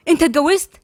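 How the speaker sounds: high-pitched accusing question; shocked demand. A narrator's reading. Heidi confronting Gabal outside his house, accusing.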